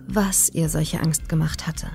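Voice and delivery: usual speaking voice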